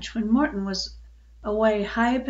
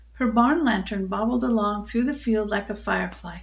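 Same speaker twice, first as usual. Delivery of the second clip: usual speaking voice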